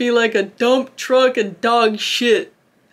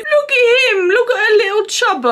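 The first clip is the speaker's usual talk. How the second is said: high, louder cooing at a pet; 11 semitones higher, 5 dB louder than usual. Real, not acted. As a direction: coo at a cute dog. Cooing at a chubby dog.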